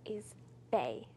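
hushed, careful words; very soft, barely voiced; whispering. Real, not acted. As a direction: whisper it for a guesser.

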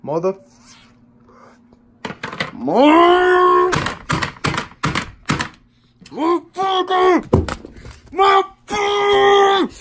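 high, strained rising rage scream; furious screaming. Real, not acted. Gamer losing it at the game, screaming in fury. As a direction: let the curse tear out of you.

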